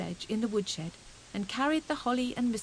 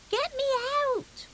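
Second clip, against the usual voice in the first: much higher, louder squeaky cry; tiny squeak; 4 dB louder than usual. A narrator's reading. Fuzzy Peg trapped in the snowball, squeaky cry. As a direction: squeak for help from inside the snow.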